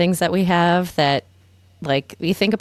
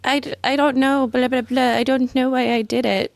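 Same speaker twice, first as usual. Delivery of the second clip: mock innocent; 7 semitones higher than usual. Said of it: "And then she acts like it's".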